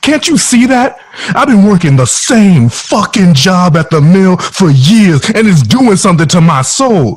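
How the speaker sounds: desperate outburst. Acted. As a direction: let it burst out in despair.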